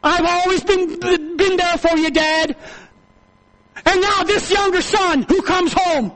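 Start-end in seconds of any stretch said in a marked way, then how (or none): 0.0-2.5 lightly voiced complaining son, high and loud; a touch of hurt resentment; resentful son. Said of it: "And says".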